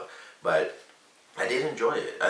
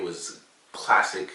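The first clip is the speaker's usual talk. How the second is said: put-on British accent; 3 dB louder, slower than usual. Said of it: "straight up British accent".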